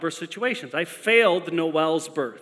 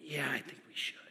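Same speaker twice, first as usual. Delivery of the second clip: much softer, calm reply; soft wife; 3 semitones lower, 15 dB quieter than usual. Retold or imitated. Wife tells husband it's time for the hospital, replying much softer. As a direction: answer quietly through the pain. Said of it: "she's like".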